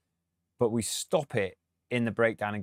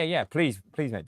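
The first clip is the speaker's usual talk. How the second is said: usual speaking voice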